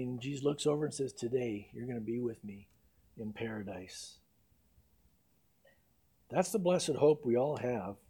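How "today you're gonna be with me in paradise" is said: tender promise. Acted. Jesus comforting the dying thief.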